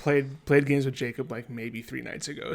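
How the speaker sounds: normal talking voice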